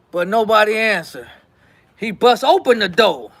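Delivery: normal talking voice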